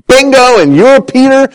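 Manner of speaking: high, emphatic exclamation; pleased, punched-up announcement; delighted congratulation. Acted. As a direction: hail the right answer with a jump in pitch. Jesus voiced congratulating Peter.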